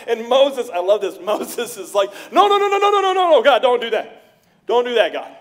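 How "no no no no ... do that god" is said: loud, much higher panicked no's; frantic pleading. Retold or imitated. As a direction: beg him to stop, panicking. Moses pleading frantically with God.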